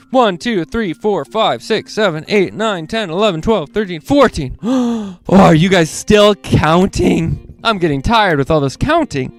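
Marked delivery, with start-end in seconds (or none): none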